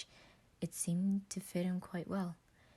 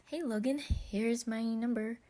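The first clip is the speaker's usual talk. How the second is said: higher, slow, eager hello; eager greeting; 6 semitones higher than usual. Acted. Patton texting Logan, eager and higher. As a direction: sound bright and keen to be friends.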